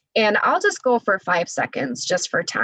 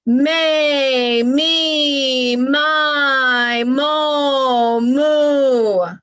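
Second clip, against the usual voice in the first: loud, deliberate, linked syllables; strong projected voice drill with the vowels kept joined; 4 semitones higher, 4 dB louder, much slower than usual. Shown as a demonstration. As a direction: send each syllable out to the far tree. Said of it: "So I'm gonna say".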